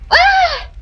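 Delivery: a sudden high yelp of fright; startled cry. Acted. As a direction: jump with a short high cry.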